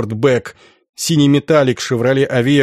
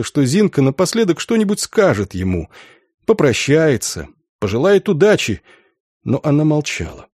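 ordinary delivery

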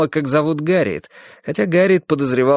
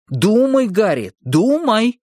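slow, high theatrical exclamation; hammy stage actor; 10 semitones higher, slower than usual. Acted. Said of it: "театрально воскликнул он". (театрально, как актёр на сцене)

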